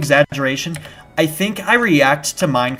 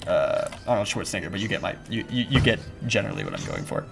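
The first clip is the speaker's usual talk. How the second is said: normal talking voice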